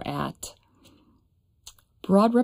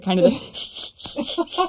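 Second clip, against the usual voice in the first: high, far-off cartoon dog voice; cartoon dog laugh; 5 semitones higher, much slower than usual. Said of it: "and if I can do it".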